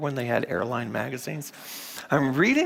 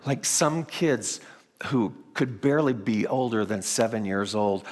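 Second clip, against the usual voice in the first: ordinary delivery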